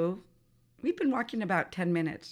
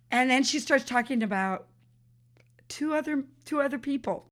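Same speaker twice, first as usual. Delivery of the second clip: normal talking voice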